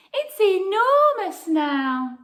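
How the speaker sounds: high, loud, slow amazement; delighted. Acted. Old lady marvelling at her empty house, high and loud. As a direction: marvel at all the space.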